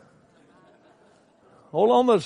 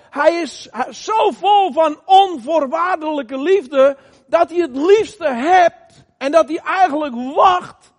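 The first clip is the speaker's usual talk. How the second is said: slow, swelling preacher's voice, stretched out; fervent preaching; 2 semitones higher, 3 dB louder than usual. Acted. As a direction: preach it, drawing each phrase out. Fervent preaching about God's love.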